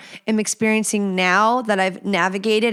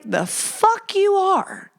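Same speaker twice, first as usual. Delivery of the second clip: much higher, slow, defiant friend; protective friend; 10 semitones higher, slower than usual. Retold or imitated. Best friend blocking the guy, slow high defiance. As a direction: drag it out in disbelief. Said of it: "she would be like".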